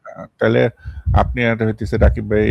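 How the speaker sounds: ordinary talk, nothing marked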